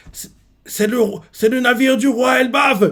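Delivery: high, loud announcement call; loudspeaker alarm. Retold or imitated. Message announces the Elbaf king's ship, alarmed. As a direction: call it out over the base. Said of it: "un message retentit".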